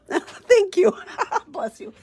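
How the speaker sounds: high, laughing thanks; real amused laugh through the words. Real, not acted. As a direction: break into laughter while speaking. Laughing at viewers' blessing after sneezes.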